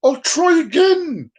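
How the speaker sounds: high, slow mock nun; hammed-up nun shout. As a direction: squeal it out in a nun's voice. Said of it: "He's like".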